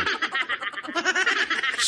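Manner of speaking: high giggling laugh. Real, not acted. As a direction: laugh at own joke. Laugh after joke. (tawang mataas)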